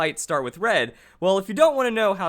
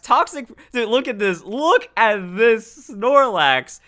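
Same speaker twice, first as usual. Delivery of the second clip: louder, insistent, stretching out the repeat; emphatic amazement; 3 semitones higher, 7 dB louder, slower than usual. Real, not acted. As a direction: make them look, hitting each word harder. Marvels at a brutal moveset.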